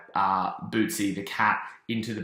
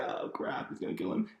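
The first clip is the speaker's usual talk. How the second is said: hushed, whispery dread in retelling; dismayed aside; 3 semitones lower, 8 dB quieter than usual. Retold or imitated. Boss Baby realizes Timmy is in danger, hushed dismay. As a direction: mutter it under the breath, mock dread.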